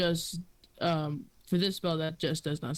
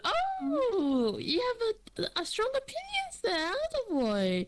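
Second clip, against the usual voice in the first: mocking old lady; slower than usual. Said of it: "she goes".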